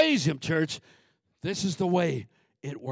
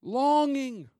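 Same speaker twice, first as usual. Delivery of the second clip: "Longing" raised and falling; emphasized longing; 10 semitones higher, 6 dB louder than usual. Shown as a demonstration. Preacher stressing the key word to the church. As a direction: lean on "Longing". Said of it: "Emphasize, operative word".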